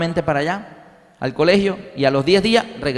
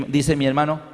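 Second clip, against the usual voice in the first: usual speaking voice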